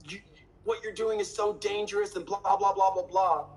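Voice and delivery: high-pitched whiny imitation of critics; mocking complaint trailing into blah-blah; mock complaining. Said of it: "bitch and complain about".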